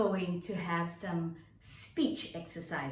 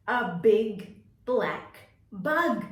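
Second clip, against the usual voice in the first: slow tongue twister; 6 dB louder, slower than usual. Shown as a demonstration. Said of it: "saying the tongue twister".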